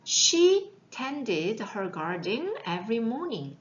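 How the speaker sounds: very slow, careful English; slow model reading. Shown as a demonstration. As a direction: spell each word out slowly for the class. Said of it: "teacher model reading".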